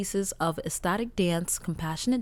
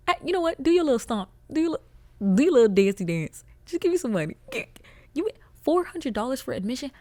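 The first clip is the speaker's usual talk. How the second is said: mock plea to neighbor; 5 semitones higher, 4 dB louder than usual. Imagined rant at neighbor.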